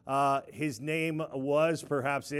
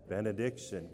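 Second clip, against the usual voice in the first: low, softer, solemn intoning; somber and serious; 8 semitones lower, 6 dB quieter than usual. Shown as a demonstration. Leading the congregation in a somber, serious chant. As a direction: intone it gravely, pitched well down. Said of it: "somber and serious form and fashion".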